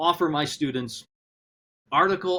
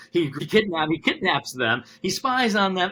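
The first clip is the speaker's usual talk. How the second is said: usual speaking voice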